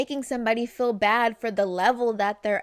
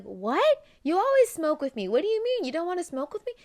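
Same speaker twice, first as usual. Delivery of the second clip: higher-pitched, put-out disbelief; incredulous friend; 6 semitones higher, faster than usual. Acted. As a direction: act out a friend who can't believe the refusal. Surprised friend questions refusal.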